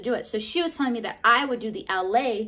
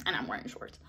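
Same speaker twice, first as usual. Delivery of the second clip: low, softer whispered aside; 10 semitones lower, 10 dB quieter, faster than usual. Real, not acted. Confessing to the viewer she's in shorts, whispered aside. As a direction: whisper it like a secret. Aside about hairy legs.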